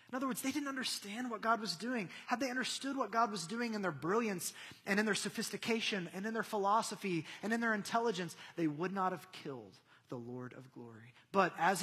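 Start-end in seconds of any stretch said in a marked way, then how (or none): none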